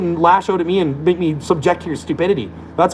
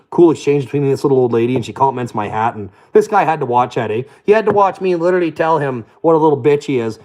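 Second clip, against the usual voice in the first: ordinary talk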